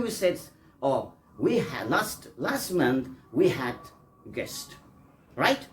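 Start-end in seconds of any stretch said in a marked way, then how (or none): none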